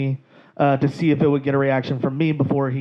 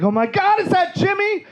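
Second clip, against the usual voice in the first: high, rising squeal of recognition; imitated astonishment. Comic as star spotting his friend, astonished. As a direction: climb to a squeaky, delighted question.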